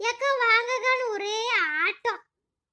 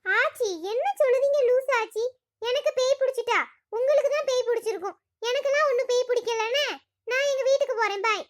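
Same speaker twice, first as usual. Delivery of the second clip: ordinary talk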